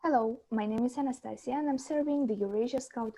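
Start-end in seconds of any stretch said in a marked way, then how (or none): none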